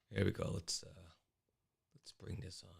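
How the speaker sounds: unvoiced whisper under his breath; whispered aside. Real, not acted. Host muttering to himself while reading chat, whispered.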